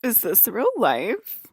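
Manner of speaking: high, slow, hammed-up disbelief; imitated amazement. Asking the guys if it's real life, mock awe. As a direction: draw it out as a mock-awed put-on voice. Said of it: "He was like".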